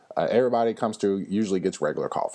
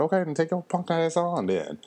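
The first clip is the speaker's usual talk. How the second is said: higher, sneering send-off; mock dismissive put-down; 8 semitones higher than usual. To a rude drive-thru customer, a mock contemptuous dismissal. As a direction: shoo him away with open contempt, lightly acted.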